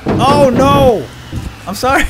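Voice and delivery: loud, high startled cry; shocked. Real, not acted. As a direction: gasp out an apology in alarm. Runs someone over by accident.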